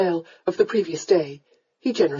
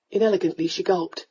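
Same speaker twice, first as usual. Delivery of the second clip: usual speaking voice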